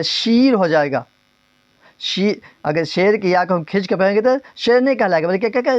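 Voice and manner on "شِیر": شیر turned into a drawn-out "sheer"; wrongly stretched ya. Shown as a demonstration. Teacher shows what stretching does, شیر said as "sheer". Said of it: "شیر کی یا کھینچ".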